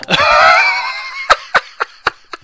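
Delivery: long, very high cackling laugh; real high-pitched laughing fit; helpless laughter. Real, not acted. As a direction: burst into loud, squealing laughter. Cracks up at turtle attack.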